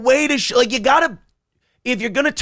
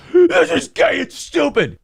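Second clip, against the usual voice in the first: mock hater whine; 9 semitones higher than usual. Haters mocking the Tampa Accords, high whiny sneer. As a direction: sneer it like a scornful commenter.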